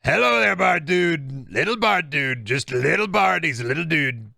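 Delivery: boastful politician impression, swooping pitch; Donald Trump. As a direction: greet them like a self-satisfied showman. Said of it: "sounded like you were slipping into a trunk. Trump".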